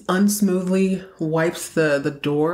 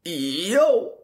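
cool-guy yo. Retold or imitated. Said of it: "Let me repeat that for y'all".

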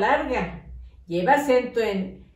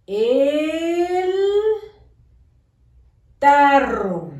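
El, a long pause, then tarro louder; loud dictation; 4 semitones higher, 5 dB louder, much slower than usual. Shown as a demonstration. Teacher dictating to pupils, loud and with a pause. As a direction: call out El, wait, then hit tarro loud. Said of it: "A la hora de que yo hago pausa".

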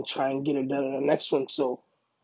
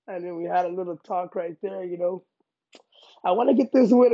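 ordinary talk, nothing marked

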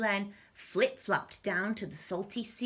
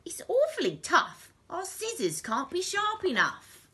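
high, complaining villain voice; pitched well up, in character; Len the crook; 12 semitones higher, 3 dB louder than usual. A narrator's reading. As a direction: gripe it like an exasperated thief. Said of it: "said Len".

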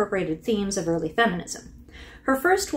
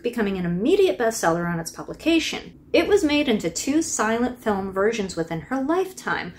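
ordinary delivery

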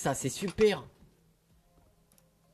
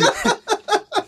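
high laugh. Real, not acted. Laugh burst.

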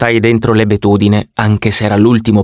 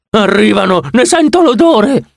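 much higher, alarmed warning cry; shouting; 9 semitones higher than usual. Acted. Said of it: "Grover urlò". (grido d'allarme, molto più acuto)

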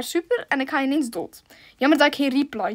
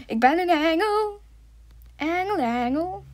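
playful; 5 semitones higher, slower than usual. Pretend. Speelse woordgrap.

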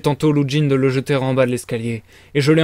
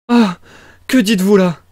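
loud, high gasp of alarm; startled, high-pitched question; 5 semitones higher, 5 dB louder than usual. A narrator's reading. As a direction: cry out in shock. Women alarmed at suicide warning.

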